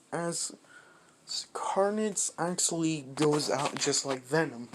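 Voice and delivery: ordinary delivery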